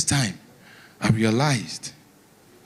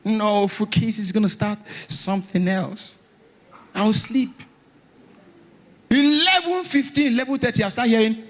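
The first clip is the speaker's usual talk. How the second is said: indignant high-pitched telling; 4 semitones higher than usual. Real, not acted. Raised-pitch indignant storytelling.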